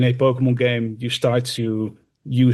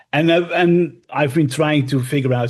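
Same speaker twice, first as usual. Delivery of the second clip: normal talking voice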